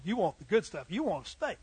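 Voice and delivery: ordinary talk, nothing marked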